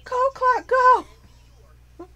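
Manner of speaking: high, loud plea to the clock, fading at the end; cheering yell. Retold or imitated. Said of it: "I'm like".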